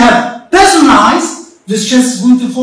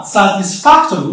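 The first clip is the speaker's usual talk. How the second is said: "satisfactory" with the stress on "fac"; stress on the third-last syllable; 4 semitones lower than usual. Shown as a demonstration. Teacher showing pupils where the stress falls, "satisfactory" stressed on "fac". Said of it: "the stress is going to be".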